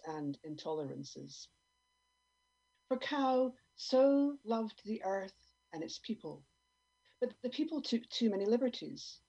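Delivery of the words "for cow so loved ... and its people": mock scripture. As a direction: intone it like a sermon. Biblical parody line.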